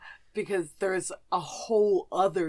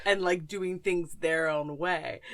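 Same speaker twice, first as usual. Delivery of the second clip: ordinary delivery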